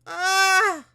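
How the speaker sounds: high, loud wail; frustrated cry. Real, not acted. Frustrated at missing dialogue.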